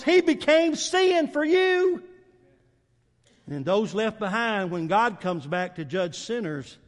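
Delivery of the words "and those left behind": normal talking voice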